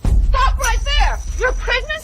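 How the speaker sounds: loud, high halt turning to disbelief; high-pitched, shocked demand; outraged command. Acted. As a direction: order her to stop, then react scandalized. Halting rival, shocked by news.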